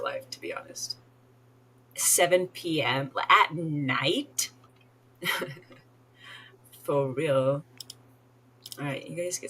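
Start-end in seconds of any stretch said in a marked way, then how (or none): 1.9-7.6 slow, louder questioning emphasis; drawn-out disbelief. Real, not acted. Tired player balking at visitors at seven at night, drawn-out disbelief. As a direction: stretch each word out in disbelief.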